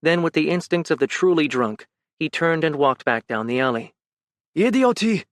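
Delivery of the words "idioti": higher, barked Romanian insult; shouted insult. Acted. Drunk man insulting Ben, shouted. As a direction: yell it over your shoulder. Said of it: "he shouted".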